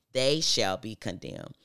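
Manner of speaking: "They" hit loud and drawn out, correcting the subject; stress on They. Acted. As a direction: proclaim it slowly, punching "They" hard. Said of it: "Excuse me, not me".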